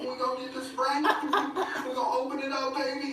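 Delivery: high, excited hammed-up rapper voice. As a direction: hype it up like a cartoon rapper. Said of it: "the [name] impression".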